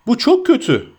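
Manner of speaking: high-pitched cry of dismay; alarmed cry. A narrator's reading. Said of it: "diye bağırdı Sam ayağa sıçrayıp". (telaşla, tiz sesle bağırarak)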